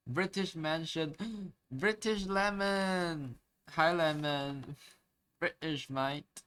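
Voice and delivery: high, drawn-out mock British; playful British accent. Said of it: "I like British accent".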